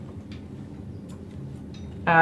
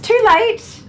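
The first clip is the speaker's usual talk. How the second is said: high squeaky quip; 6 dB louder than usual. Acted. Joke about memorized passcodes.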